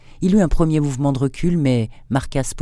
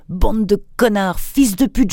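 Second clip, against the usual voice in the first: furious outburst rising much higher and louder; angry insults; 8 semitones higher, 3 dB louder than usual. A narrator's reading. The marquis, struck in the dark, curses his assailants in a furious outburst. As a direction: spit the insults at the unseen attackers.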